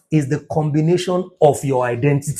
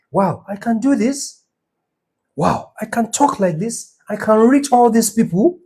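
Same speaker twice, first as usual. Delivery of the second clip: higher-pitched wonder at yourself; amazed discovery; 7 semitones higher than usual. Acted. Speaker voicing someone discovering their purpose, amazed. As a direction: voice lifted in acted amazement. Imagined self discovering new strengths.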